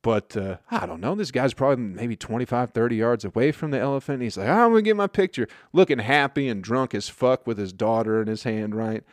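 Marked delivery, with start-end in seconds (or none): 4.5-5.5 eager mock dad. Eager dad voiced in story.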